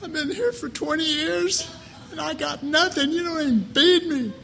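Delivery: high, whiny mock complaint; Jesus. Jesus grumbling after twenty years locked in a heart, high comic whine. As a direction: complain like a neglected prisoner, high and laughing. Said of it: "Jesus is there standing at the bar going".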